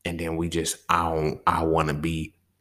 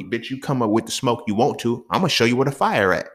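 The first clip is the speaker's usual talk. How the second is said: normal talking voice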